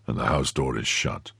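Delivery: low, soft man's report; grave Athos. A narrator's reading. Athos returning from the door, low and grave.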